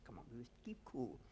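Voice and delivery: whispering to herself to stay calm; whispered thought. Retold or imitated. Woman steadying herself on the beach: whispered thought. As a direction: breathe it as a private whisper.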